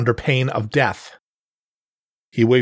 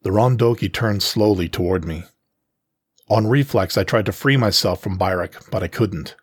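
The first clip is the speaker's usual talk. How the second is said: usual speaking voice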